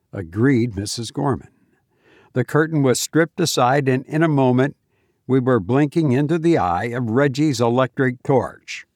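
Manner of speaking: plain reading voice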